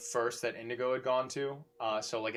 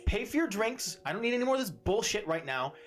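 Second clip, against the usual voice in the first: higher, firm barmaid ordering them out; stern barmaid; 7 semitones higher, faster than usual. Acted. Barmaid Mywin demanding they pay and leave, stern. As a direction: lay down the law.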